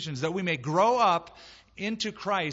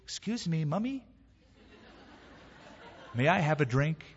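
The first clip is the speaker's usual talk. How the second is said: put-on polite child. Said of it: "is more gentle and instead of crying would say".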